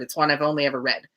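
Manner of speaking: normal talking voice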